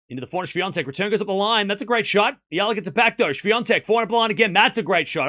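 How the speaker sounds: excited streamer calling the rally; live play-by-play. Acted. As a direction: call each shot as it happens.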